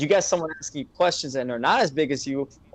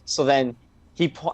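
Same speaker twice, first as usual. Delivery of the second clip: usual speaking voice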